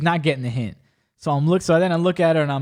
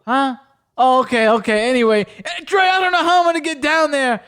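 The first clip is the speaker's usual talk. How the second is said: high and loud, hammed-up worry; over-the-top fake phone drama; overacted phone talk; 8 semitones higher, 4 dB louder than usual. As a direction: play it big for the girl listening. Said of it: "acting skills were a little over the top".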